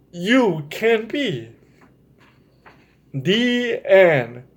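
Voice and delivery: lilting bedtime-story reading; reading to children. Acted. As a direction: read it like a picture book to little kids. Reads storybook ending to children.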